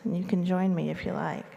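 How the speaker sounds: usual speaking voice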